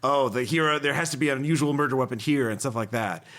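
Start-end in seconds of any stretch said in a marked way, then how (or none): none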